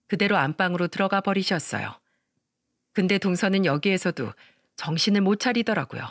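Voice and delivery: usual speaking voice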